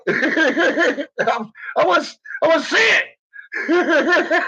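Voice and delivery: real laughter in the voice; laughing. Real, not acted. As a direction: barely get it out for laughing. Laughing while talking.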